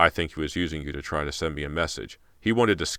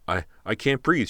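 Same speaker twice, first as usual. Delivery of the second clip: choking; 6 semitones higher, 4 dB louder than usual. Acted. Being strangled.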